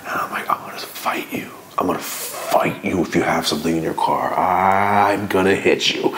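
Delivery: whispered threat through gritted teeth; threatening whisper. Retold or imitated. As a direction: hiss the threat so the cop can't hear. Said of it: "E S P talking to each other telepathically".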